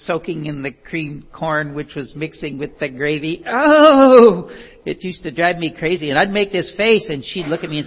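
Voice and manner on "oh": loud, high drawn-out "Oh!"; exasperated groan. Acted. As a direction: let out a big groan of disgust at the mess. Disgust at runny food mixing.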